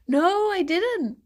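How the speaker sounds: ordinary talk, nothing marked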